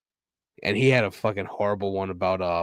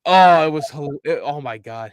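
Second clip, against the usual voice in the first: ordinary talk, nothing marked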